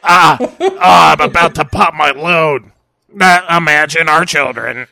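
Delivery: loud, breathy lusty groans; hammed-up lusty moaning. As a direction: ham up a lusty climax. Acting mummy and Nolte sex.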